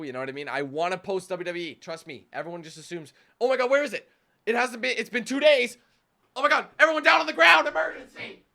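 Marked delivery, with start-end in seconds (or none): 3.4-8.4 loud, high hammed-up alarm; mock panic. Impatient viewers cry out over a late upload, mock panic. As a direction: play a viewer raising a frantic false emergency.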